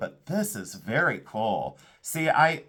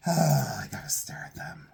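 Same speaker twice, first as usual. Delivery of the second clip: breathy whispered sigh; whispered longing sigh; 5 semitones higher than usual. Real, not acted. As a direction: sigh it out under your breath. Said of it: "We have to leave those out".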